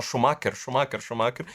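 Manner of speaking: "Schumacher" with a hard k, shu-MA-ker; Galvão. Retold or imitated. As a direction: repeat "Schumacher" with a k and an -er ending. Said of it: "Que ela fala assim". ("Schumacher" dito "chumáquer", com k)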